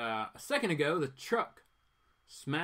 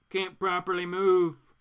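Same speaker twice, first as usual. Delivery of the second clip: frustrated outburst; 3 semitones higher, 6 dB louder, slower than usual. Acted. Voicing frustrated Green Arrow.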